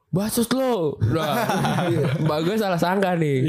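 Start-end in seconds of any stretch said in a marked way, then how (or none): 0.1-1.0 high, mock-defiant kid outburst; childish retort. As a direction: imitate a crying kid talking back. Acts own childhood retort to mother.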